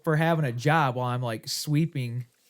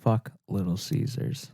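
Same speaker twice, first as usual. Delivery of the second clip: mock disgust; 3 semitones lower, slower than usual. Joking disgust at the pizza chain.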